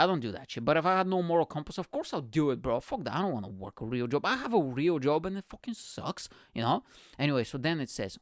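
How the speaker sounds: ordinary talk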